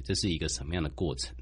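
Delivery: normal talking voice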